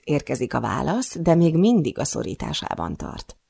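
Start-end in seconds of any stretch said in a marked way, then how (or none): none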